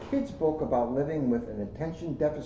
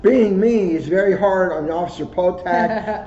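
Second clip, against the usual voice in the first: louder and higher, hammed-up emphasis; loud emphatic repeat; 7 semitones higher, 11 dB louder than usual. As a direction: punch it out for a laugh. Jokes about his colleague.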